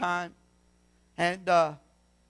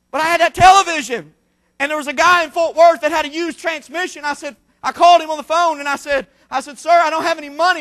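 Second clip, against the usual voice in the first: loud, high preacher's testimony; preaching; 10 semitones higher, 13 dB louder than usual. Acted. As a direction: preach it with rising fire to the church.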